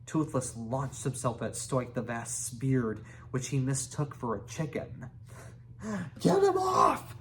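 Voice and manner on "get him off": much higher, louder cry; panicked high yell. A narrator's reading. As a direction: chief yelps at dragon on beard. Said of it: "said Stoik".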